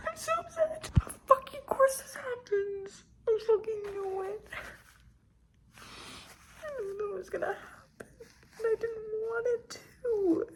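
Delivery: real crying over a plot twist; choked-up high whimpering voice; crying upset. Real, not acted.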